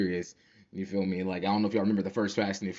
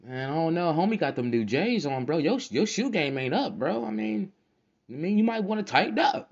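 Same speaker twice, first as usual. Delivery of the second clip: 1 high mock concern; 8 semitones higher than usual. Mock advice to friend, high voice.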